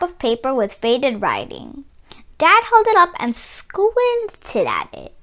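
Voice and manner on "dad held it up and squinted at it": ordinary delivery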